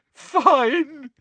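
Hermione's tearful high surrender; sobbing. A narrator's reading. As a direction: give in through sobs. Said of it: "now sobbing into her hands".

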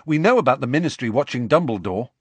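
fast, eager push; Harry eager for the story; hurried. A narrator's reading. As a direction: hurry him to the point. Harry hurrying Hagrid along.

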